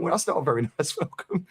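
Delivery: high, prim mum voice; prim polite mother; mock prim welcome. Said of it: "my mum's so polite".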